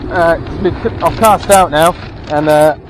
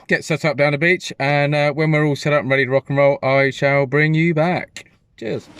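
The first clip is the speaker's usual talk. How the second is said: ordinary talk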